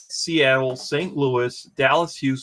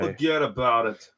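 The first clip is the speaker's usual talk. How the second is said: put-on New York accent; 2 semitones lower than usual. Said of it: "it's only 'cause it's New York".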